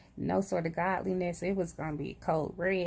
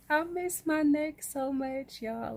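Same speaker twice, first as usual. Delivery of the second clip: high, drawn-out mock longing; playful gushing; 7 semitones higher than usual. Real, not acted. Vlogger showing viewers her neck, high playful gushing. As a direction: gush slowly in a raised pitch.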